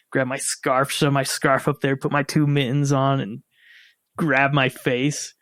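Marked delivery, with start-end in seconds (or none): none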